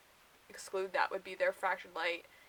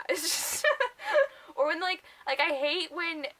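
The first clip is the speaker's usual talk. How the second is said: high squealing laugh through the words; giggling fit; laughing hard; 8 semitones higher, 4 dB louder than usual. Real, not acted. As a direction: crack up mid-sentence. Cracking up over pet name.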